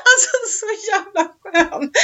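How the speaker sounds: high, slow words through tearful laughter; laughing. Real, not acted. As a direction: still crying with laughter. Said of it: "laughing fit about friend".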